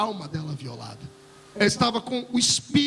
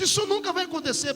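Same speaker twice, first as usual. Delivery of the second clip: imitated girl's protest, pitched high; high retold objection; insistent refusal; 9 semitones higher than usual. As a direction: act out her stubborn denial in a raised pitch.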